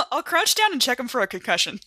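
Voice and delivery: ordinary talk, nothing marked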